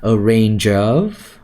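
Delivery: A RANGE OF linked slowly as a-rain-juv; drawn-out A RANGE OF with the words joined; connected sounds. Shown as a demonstration. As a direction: run "range" straight into "of". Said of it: "You connect sounds".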